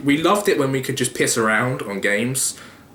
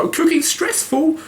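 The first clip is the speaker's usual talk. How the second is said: very high mock-whiny mum voice. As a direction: whine it like a frazzled mum. Imitating mum while cooking.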